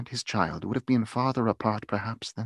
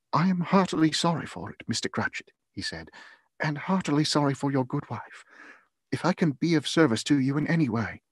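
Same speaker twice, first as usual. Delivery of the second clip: higher-voiced, kindly condolence; sympathetic gentleman; 6 semitones higher than usual. A narrator's reading. Nephew consoling a bereaved father, heartily sorry. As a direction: offer heartfelt sympathy in a raised voice.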